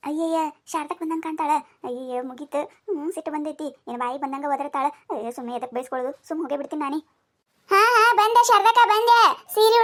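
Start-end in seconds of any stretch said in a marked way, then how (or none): 0.1-7.0 much softer and lower, under the breath; a private grumble, far softer and lower; muttered aside. Acted. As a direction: mutter this so Sharadakka can't hear. Muttered aside about angry neighbour.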